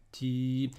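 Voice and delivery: "ti" with a long, steady i; "ti" held out evenly; prolonged vowel. Shown as a demonstration. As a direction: stretch the i of "ti". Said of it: "la prolongation longue".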